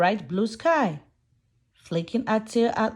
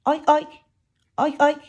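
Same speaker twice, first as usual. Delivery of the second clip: higher, louder piggy oinks; quick playful oinks; 4 semitones higher, 3 dB louder, faster than usual. Pretend. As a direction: grunt like a muddy little pig. Piggies in farm rhyme.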